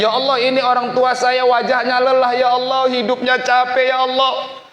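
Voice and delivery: very high, loud pleading to God; retold funeral prayer, cried out at full force; impassioned prayer. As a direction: plead desperately for your father at the top of your range. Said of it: "Saya bilang".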